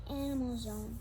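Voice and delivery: usual speaking voice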